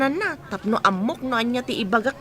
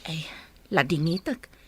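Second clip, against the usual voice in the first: whisper; 8 semitones lower, 5 dB quieter than usual. Acted. Maid whispers a complaint aside.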